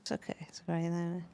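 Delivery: quiet reassuring near-whisper; soft comforting. Real, not acted. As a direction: gently soothe someone in tears. Host comforting crying guest.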